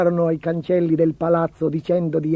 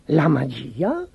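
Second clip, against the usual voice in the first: slow, drawn-out question swooping up; slow rising query of amazement; astonished echo; slower than usual. A narrator's reading. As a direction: stretch it out in growing disbelief. Second, bigger amazed echo.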